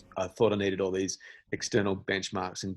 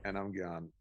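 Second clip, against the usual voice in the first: ordinary delivery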